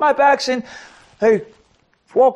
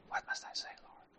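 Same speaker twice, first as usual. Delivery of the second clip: barely voiced plea to God; whispered prayer; 22 dB quieter than usual. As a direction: breathe the question upward, secretly. Retold silent prayer amid crowd.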